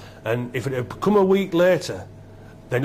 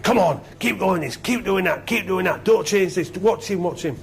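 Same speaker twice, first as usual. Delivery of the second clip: higher-pitched, insistent half-time rallying; urgent coach; 6 semitones higher than usual. Acted. Imagined coach urging players at half-time, urgent and insistent. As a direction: drive each order home like a coach. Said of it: "you'd expect a coach".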